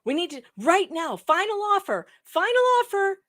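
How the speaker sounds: pushy mock ultimatum, climbing high; mock urgent demand. Mimicking Jane's urgent demands.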